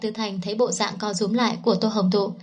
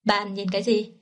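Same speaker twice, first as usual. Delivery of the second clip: mildly cold question, understated. A narrator's reading. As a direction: ask with a touch of coolness. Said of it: "giọng nói của anh vẫn lạnh lùng hỏi". (hơi lạnh lùng)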